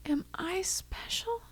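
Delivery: imitating a frail grandma, teary whisper; crackly, sad voice. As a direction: ask it in a fragile whisper about to cry. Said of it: "crackly, sad, almost gonna cry herself voice".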